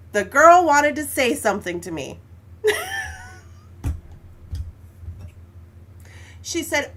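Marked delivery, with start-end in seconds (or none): 2.2-6.4 breathless laugh. Real, not acted. Laughing at the girl's comment.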